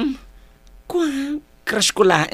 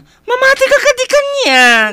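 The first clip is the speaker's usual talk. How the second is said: high insistence; 5 dB louder than usual. Acted. Insisting to sister.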